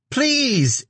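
very high squealed creature plea; begging. A narrator's reading. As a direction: squeak it out, pleading for your life. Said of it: "it begged".

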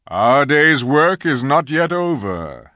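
great lion calling out in measured tones; commanding lion leader. A narrator's reading. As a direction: address the whole army as Aslan. Aslan calls for silence and addresses army.

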